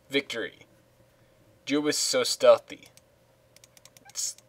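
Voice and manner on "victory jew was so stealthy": ordinary talk, nothing marked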